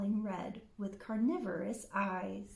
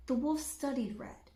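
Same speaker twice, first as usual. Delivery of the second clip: ordinary delivery, nothing marked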